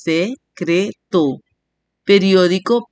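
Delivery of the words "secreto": SE-CRE-TO, slowly in three beats; split into syllables. Shown as a demonstration. Teacher counting three syllables, syllable by syllable. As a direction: say "Se-cre-to" one drawn-out syllable at a time. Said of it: "tres sílabas".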